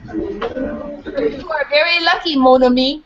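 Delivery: put-on drawn-out French accent for the kids. A narrator's reading. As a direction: savour each word like a proud French bulldog. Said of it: "has a funny French accent".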